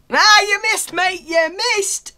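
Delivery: high, loud crowing; gleeful taunt. Real, not acted. Player to an opponent who missed, gleeful shouted taunt. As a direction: crow at the bad shot.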